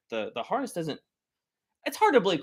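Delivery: ordinary talk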